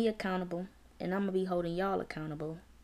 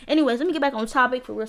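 quick louder Anyways, rushing back to topic; hurried, louder; 5 semitones higher, 8 dB louder, faster than usual. Real, not acted. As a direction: snap back to business fast. Snapping back from playing.

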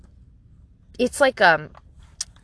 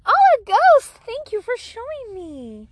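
very high, delighted singsong; 13 semitones higher, 4 dB louder than usual. Real, not acted. Talking to toddler beside her.

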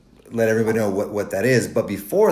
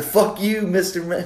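much higher, laughing imitation of a rude kid; mock kid retort; 9 semitones higher than usual. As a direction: play a bratty sixth grader mouthing off.

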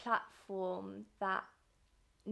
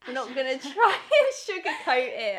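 much louder, laughing out the word; giggling at her own word search; laughing through it; 4 semitones higher, 12 dB louder than usual. Real, not acted. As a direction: burst out laughing mid-sentence.

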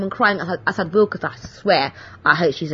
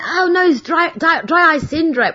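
high, chirpy cover story; mock breezy excuse; 9 semitones higher than usual. To the butcher, a high chirpy fake excuse. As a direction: brush off the tears with a bright fib. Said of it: "And I'm like".

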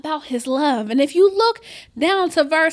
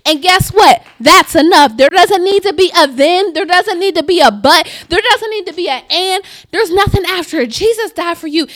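fiery preaching; 7 dB louder than usual. Acted. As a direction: preach it full force to the church. Preaching at full force.

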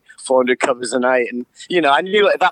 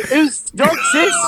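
surprised; 12 semitones higher, slower than usual. Retold or imitated. Confused yell at dog.

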